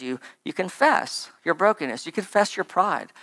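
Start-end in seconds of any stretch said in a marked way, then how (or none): none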